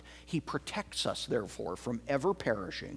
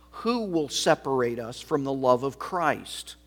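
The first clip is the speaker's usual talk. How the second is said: louder, rising scripture reading; ringing pulpit reading; preaching scripture; 6 dB louder than usual. Acted. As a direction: proclaim it to the congregation. Reads Romans 8 with force.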